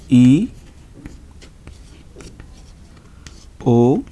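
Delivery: A, A, E, O, U as "ah, ay, ee, oh, oo"; teacher modelling A, A, E, O, U as pure vowels; vowel revision drill. Shown as a demonstration. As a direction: recite A, A, E, O, U with long gaps. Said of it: "Once again we revise this".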